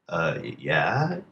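slow, stammered, unsure yes; acting out a reluctant, halting answer; hesitant agreement. Friend answers a puzzling lottery question, hesitant.

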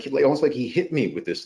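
ordinary delivery

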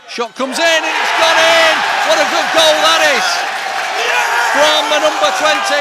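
loud, high goal shout; long goal yell. Real, not acted. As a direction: yell it as the ball goes in. Goal scored, big yell.

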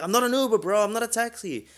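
very high, loud mock protest; exasperated protest. Driver insists to drunk passenger, exasperated high-pitched protest. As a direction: cry out in hammed-up exasperation.

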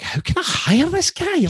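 high, eager question; excited. Retold or imitated. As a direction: ask it with rising excitement. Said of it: "I'm like".

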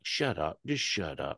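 mock grumbled shut up. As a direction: mutter it, embarrassed and annoyed. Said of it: "And you're like".

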